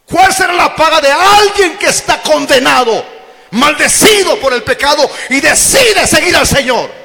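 high, loud impassioned cry; preaching shout. Real, not acted. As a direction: hammer the question at the congregation. Preaching climax. (pregunta gritada con pasión de predicador)

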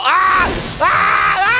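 high repeated screams; high-pitched cries of alarm; panicked screams. Real, not acted. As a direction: scream in short frantic bursts. Spraying at spider in panic.

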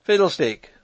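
loud, high exclamation; brusque dismissal. A narrator's reading. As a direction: dismiss it briskly and forcefully. Brisk dismissal in acted quarrel.

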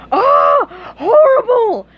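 very high, loud shriek; horrified scream. Acted. Patrick is shown the drawing again, screaming. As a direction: shriek in exaggerated horror. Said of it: "Patrick screamed again".

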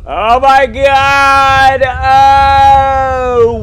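long, slow, high scream. Real, not acted. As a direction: drag out a terrified scream. Sudden drop on the ride.

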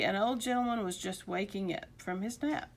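usual speaking voice

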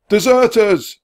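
high, loud alarm cry; centurion's shout. A narrator's reading. Centurion pointing at runaways, shouting. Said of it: "the centurion shouted again".

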